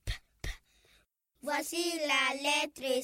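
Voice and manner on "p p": "P" as a short unvoiced p pop; letter sound P. Shown as a demonstration. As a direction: pop "P" twice quietly. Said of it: "elle fait toujours P". (son de la lettre P)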